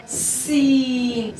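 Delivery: सीन stretched out very slowly with a long hissing whistle on the s; teacher demonstrating the whistle sound; whistled s. Shown as a demonstration. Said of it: "सीटी किस तरह करेंगे".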